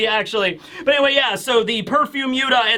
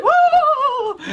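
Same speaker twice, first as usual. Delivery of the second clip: high, loud swooning whoa; faint dramatically; mock swoon; 3 dB louder than usual. Crew fainting at Shaki's beauty, high swooning whoa.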